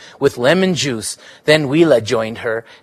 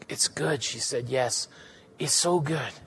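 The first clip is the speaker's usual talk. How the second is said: soft, breathy near-whisper of relief; a dreamy whispered murmur; hushed moan; 11 dB quieter than usual. Acted. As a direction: let it sigh out of her, hushed. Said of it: "She moaned".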